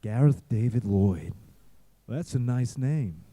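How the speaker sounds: low and slow, mimicking Frakes greeting him; deep slow director voice. Actor recalls director greeting him, low slow mock voice. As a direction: drop the pitch low, drawl it slowly. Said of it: "He was like".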